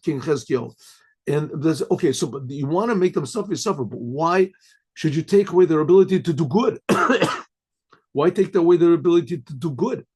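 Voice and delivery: normal talking voice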